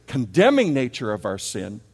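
normal talking voice

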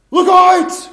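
sudden high yell of alarm; shouting a warning at the crowd. Retold or imitated.

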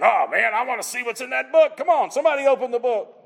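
mock frustrated griping; impatient whine. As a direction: play a kid stamping for the book. Mock frustrated John kicking dirt.

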